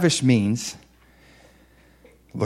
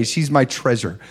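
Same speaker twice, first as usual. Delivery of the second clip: ordinary delivery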